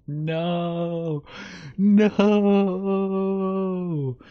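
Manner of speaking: high, loud, laughing protest; giggling squeal of protest. Real, not acted. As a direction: squeal the no's through laughter. Laughing panic over leaked info.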